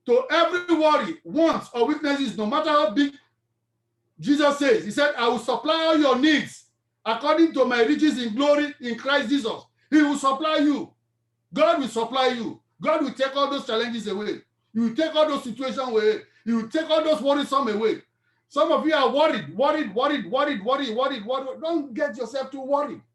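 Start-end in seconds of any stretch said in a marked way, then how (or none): 0.1-18.0 loud, high, driving sermon; rousing pulpit preaching; full-force preaching. Real, not acted. 18.5-21.6 worried hammered over and over; chanting repetition; repeated word preaching. Real, not acted.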